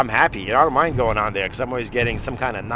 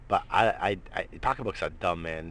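ordinary talk

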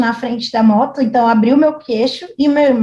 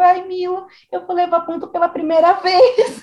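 crying quote; 6 semitones higher than usual. Retold or imitated. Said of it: "E eu chorava".

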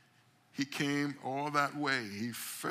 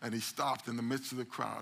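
ordinary talk

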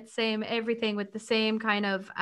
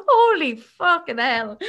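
laughing exasperation; 3 semitones higher, 6 dB louder, slower than usual. Real, not acted. Overwhelmed by a sad book.

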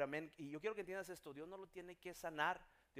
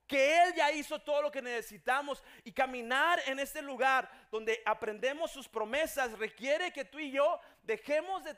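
loud, emphatic sermon delivery; full-force preaching; 8 semitones higher, 10 dB louder than usual. Acted. Preacher urging people to trust it is done, forceful preaching. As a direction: exhort the congregation with rising fervor.

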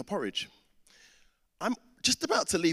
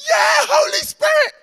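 piercingly high, loud, drawn-out yell; hammed-up little-kid scream; imitated child's shout; 14 dB louder, slower than usual. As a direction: mimic an overjoyed, desperate toddler hollering. Imitates daughter crying out for syrup.

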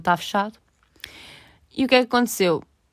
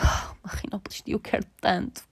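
soft unvoiced wishful whisper; 7 dB quieter than usual. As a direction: breathe it like a secret longing. Retold inner wish, whispered.

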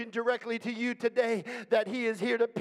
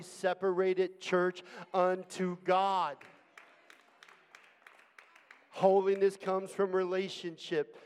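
lower, softer sermon reading; rally speech; 7 semitones lower, 5 dB quieter than usual. Acted. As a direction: read the message steadily.

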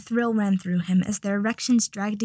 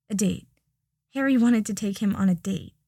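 ordinary delivery, nothing marked

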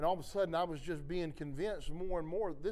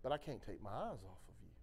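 much softer, lower and gentle; hushed, tender reassurance; tender voice of God; 8 semitones lower, 10 dB quieter than usual. Retold or imitated. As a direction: speak it like a loving whisper of comfort. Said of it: "he's just like".

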